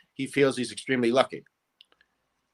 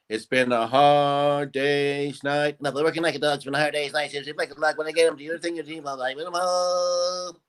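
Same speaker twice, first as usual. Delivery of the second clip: record played too fast; 3 semitones higher than usual. Shown as a demonstration. Man imitating his childhood records at forty-five, sped-up singing. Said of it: "I would play the L Ps at forty-five".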